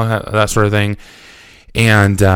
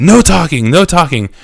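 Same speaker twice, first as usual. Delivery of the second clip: higher, insistent ban on talking; barked order; 8 semitones higher, 4 dB louder than usual. Retold or imitated. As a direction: imitate a guard silencing someone. Acted guard ordering silence.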